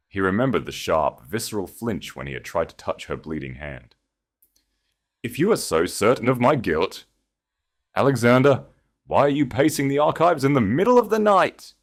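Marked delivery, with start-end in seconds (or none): 5.2-11.7 defiant challenge. A narrator's reading. Remembered defiant words of Seraphina.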